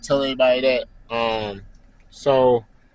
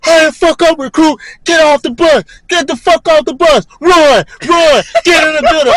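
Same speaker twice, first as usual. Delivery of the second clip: drill instructor yelling; 8 dB louder than usual. Retold or imitated.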